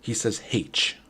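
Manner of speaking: "H" said the Rhode Island way, imitating his father; father's Rhode Island H. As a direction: lightly mimic his dad's "H". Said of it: "when he says the letter H".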